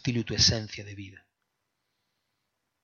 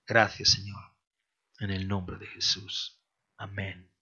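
prayer; 3 semitones lower than usual. Acted. Leads closing prayer.

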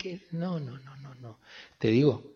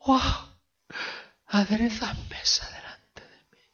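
higher, mostly whispered, awed prayer; 8 semitones higher than usual. Acted. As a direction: pray it breathlessly, in awe. Voices David praying the psalm.

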